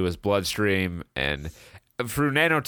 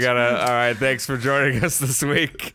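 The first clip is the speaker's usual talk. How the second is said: laughing outro; 5 semitones higher than usual. Real, not acted. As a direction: wrap up while still laughing.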